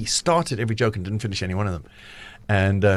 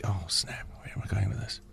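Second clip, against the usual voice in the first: mock muttered panic; 4 semitones lower, 12 dB quieter than usual. Mock inner thought mid-joke.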